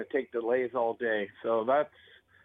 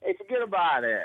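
higher-pitched New York wise guy; New York accent; 5 semitones higher than usual. Shown as a demonstration. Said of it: "can I have a New York".

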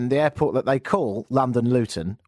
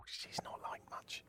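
whisper; 26 dB quieter than usual. Real, not acted. Aside about premier.

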